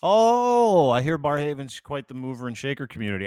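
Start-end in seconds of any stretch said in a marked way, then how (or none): 0.0-0.9 high, loud exaggerated gasp of an oh; mock surprised oh. Teasing his co-host about his suburb, a big mock surprised oh. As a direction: jump up into a big fake surprised oh. Mock shock at Barrhaven news.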